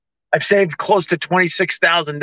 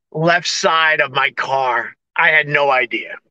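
ordinary delivery

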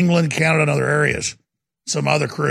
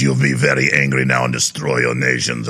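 much lower, strange villain voice; Schwab impression. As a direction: play him as a menacing foreign elite. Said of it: "Schwab says".

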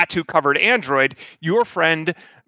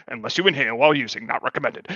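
rushed, quieter fine-print mumble; fast disclaimer; 5 semitones lower, 4 dB quieter, faster than usual. Acted. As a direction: rattle off the legal small print.

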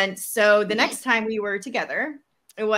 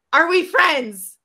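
pointed question; 9 semitones higher, 8 dB louder, slower than usual. Retold or imitated. Friend asking pointed question.

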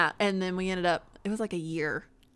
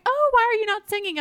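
high, loud mock question; exaggerated high-pitched imitation; imitating nosy people; 13 semitones higher, 6 dB louder than usual. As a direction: mimic people asking, pitched way up. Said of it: "so many people be like".

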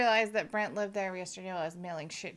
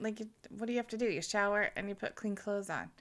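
ordinary talk

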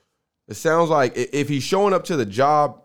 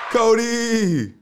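high, drawn-out roll call; announcer call-out; 9 semitones higher than usual. Pretend. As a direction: sing out the name like a host. Host calls out crew names.

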